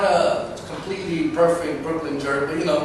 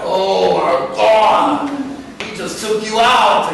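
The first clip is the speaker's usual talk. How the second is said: loud, drawn-out crowd uproar; crowd reaction; 6 semitones higher, 7 dB louder, much slower than usual. Retold or imitated. The crowd seeing a dancer humiliated, loud mock uproar. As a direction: imitate a stunned crowd, high and loud. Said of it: "The crowd ... They'll be like".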